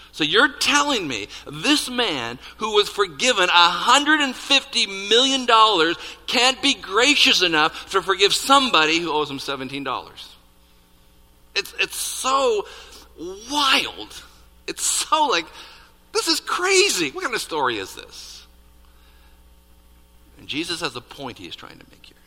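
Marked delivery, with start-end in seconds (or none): 0.1-10.3 incredulous outrage. Acted. Preacher challenging the unfairness of the story, incredulous. 11.5-18.4 very high, loud disbelief; flabbergasted. Acted. Preacher stunned by the forgiven man's behavior, flabbergasted.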